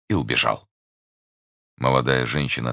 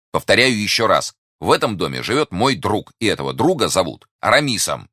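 higher, emphatic restatement; emphatic insistence; 6 semitones higher, 4 dB louder than usual. Acted. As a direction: drive the claim home, voice raised. Repeats claim to doubting lady.

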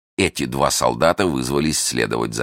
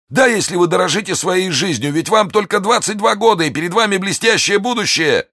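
high, strained voice of a wounded bandit; frightened bandit. Acted. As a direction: plead in a thin high voice.

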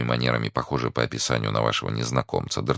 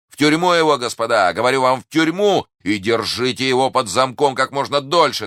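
high, loud order to jail him; indignant command; 11 semitones higher, 6 dB louder than usual. A narrator's reading. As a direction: demand it loudly, with a show of outrage. Feigned fury, ordering merchant jailed. (громкий возмущённый приказ)

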